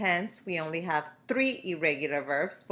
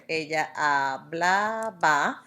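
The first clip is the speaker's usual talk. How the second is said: "Ella hablaba" read out slowly and carefully, stressing the ending "aba"; teacher modelling "Ella hablaba" slowly; slow model answer; slower than usual. Shown as a demonstration. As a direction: spell out "hablaba" slowly for students writing it down. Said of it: "now I'm going to add aba".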